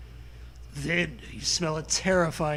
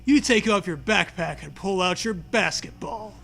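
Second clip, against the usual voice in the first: usual speaking voice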